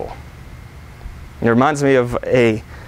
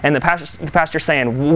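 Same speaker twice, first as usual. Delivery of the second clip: ordinary delivery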